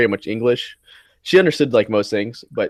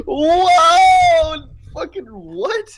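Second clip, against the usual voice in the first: high, loud startled shout; shocked whoa; 4 dB louder, much slower than usual. Retold or imitated. Friend retelling his shocked reaction to the creepy joke, high laughing shout. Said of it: "I was like".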